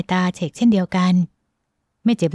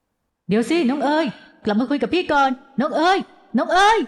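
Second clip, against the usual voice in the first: high-pitched calling out, climbing higher; echoing shout; 8 semitones higher than usual. Acted. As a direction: call after someone vanishing, voice rising each time. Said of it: "เสียงตะโกนก้องของชายหนุ่ม". (ตะโกนเรียกก้อง เสียงสูงขึ้นเรื่อยๆ)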